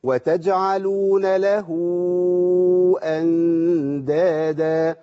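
وتجعلون له read slowly, the هاء flowing into a long واو before أندادا; joined recitation. Shown as a demonstration. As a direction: hold أندادا long and slow. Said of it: "أوصلها". (وصل له بأندادا)

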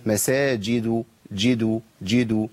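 "مساجد" slow, with jim and dal each cleanly placed; distinct jim and dal. Shown as a demonstration. Teacher drilling a tricky join, distinct jim and dal. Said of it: "لابد من بيان مخرج الجيم ومخرج الدال".

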